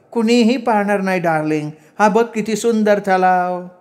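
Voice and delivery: high woman's voice, unhurried; coaxing sweetheart. A narrator's reading. Woman showing her darling the lake, high coaxing. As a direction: reassure him in a high, put-on pitch.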